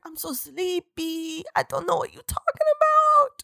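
hammed-up sleepy playing dumb; mock sleepy Bella. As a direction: play dumb sleepily, trailing into a whisper. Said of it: "And she's like".

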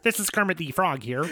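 lightly voiced Kermit, soft and laughing; a half-hearted Kermit through giggles; Kermit the Frog. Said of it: "I'll be Kermit".

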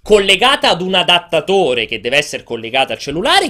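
ordinary talk

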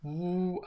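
sustained mock foghorn blast; foghorn imitation. Shown as a demonstration. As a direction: sound a steady foghorn note. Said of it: "it gets louder and louder".

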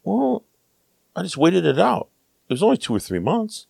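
high, soft imitation, blithely unbothered; carefree American traveller. Fellow hiker telling how she got cured, blithe and soft. Voices her blithe non-answer.

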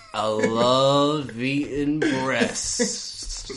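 very slow, leering drawl; creepy slow voice. Pretend. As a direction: creep savoring every word. Creepy breast-eating bit, face horrifying.

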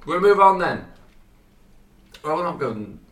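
sudden high, loud sing-song; chirpy segue. Acted. Vlogger chirping to viewers, moving on high and loud. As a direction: bounce into the next topic.

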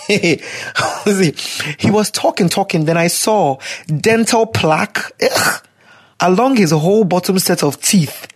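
usual speaking voice

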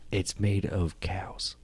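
slow, whispered excuse; evasive servant. Acted. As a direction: offer the lie slowly, under your breath. Servant lies nervously.

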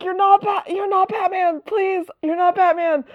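very high, begging and worn-out voice; high exasperated Dick. Retold or imitated. Dick pleading with Jason to stop, very high exasperated whine. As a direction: beg him in a high tired whine. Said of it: "Dick's like".

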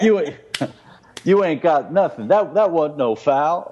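hammed-up court taunt; mock trash talk. Co-host imagining the writer's trash talk, mildly hammed up. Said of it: "whatever dialogue's playing in his mind".

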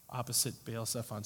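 usual speaking voice

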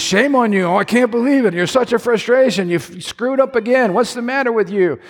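scolding preacher. Retold or imitated. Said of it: "our idea of holiness It's".

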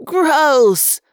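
shout. A narrator's reading. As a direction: yell it out, one long loud word. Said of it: "another voice shouted".

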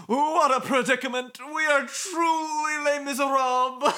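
very high laughing lament; melodramatic. Acted. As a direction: wail it theatrically. Voices Motoi.

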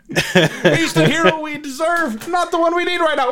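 high strained froggy voice; Kermit as Batman. Retold or imitated. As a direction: squeeze it up into Kermit. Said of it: "I don't know what the fuck that voice is".

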